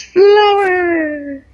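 sudden squeaky jump way up, slow and loud; voice cracking up. Real, not acted. Naming the flower weapon, voice cracks into a shriek. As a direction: voice breaks into a high squeal on "flower". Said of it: "I don't know why my voice just did that".

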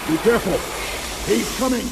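sharp alarm as the enemy comes; urgent warning. Acted. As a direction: call out the danger fast.

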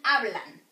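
"hablan" with a loud first syllable; slow model of "hablan", first syllable strong; next-to-last syllable stressed. Shown as a demonstration. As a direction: hit the HA in "hablan". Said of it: "the strong one is the next to last".

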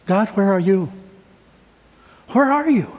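imitating a desperate plea, rising on the last where; pleading cry to God. Pastor voicing a sick man pleading with God. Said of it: "he's really asking".

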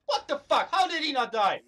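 loud, high exasperated yelling; outraged disbelief. Real, not acted. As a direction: demand an answer in angry disbelief. Opponent survived the player's shots.

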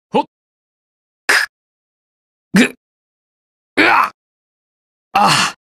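brief gasps on impact; short hurt grunts. Acted. Fighter taking light hits, short pained grunts. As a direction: grunt as if struck. Recorded damage reaction sounds.

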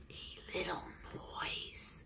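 very soft voiceless whisper. Acted. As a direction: barely breathe the words. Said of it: "whispered the rabbit".

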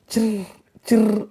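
high squirrel chirps; two little high trills; squirrel chirring. A narrator's reading. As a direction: imitate a squirrel's chirring call. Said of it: "खारुताई मात्र चिरकत".

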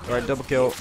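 ordinary talk

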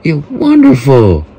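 copying the game's squeaky voice crack; mock the game announcer's cracking voice. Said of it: "He's in a permanent voice crack".

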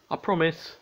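high, clear little-sister promise; agreeing child. A narrator's reading. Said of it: "Pinga agreed".

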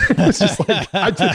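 words breaking into real laughter; can't get it out for laughing; high giggly laughing through the words. Real, not acted. Laughing at the absurd offer.

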